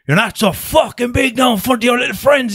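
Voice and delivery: high, strained guard's shout; mock roaring taunt. Guard taunting him at the cell van door, mock roar. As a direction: imitate an angry guard's gloating yell. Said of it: "that was roaring at".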